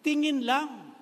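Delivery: "tingin lang" belted high and loud as the point; emphatic punchline. Shown as a demonstration. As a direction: land it like a preacher's punch. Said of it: "pagkasama natin ang Diyos".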